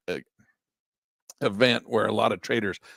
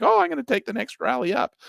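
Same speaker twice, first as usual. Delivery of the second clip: mock eager trader; 13 semitones higher than usual. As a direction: play the hopeful trader, voice jumped up. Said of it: "You're like".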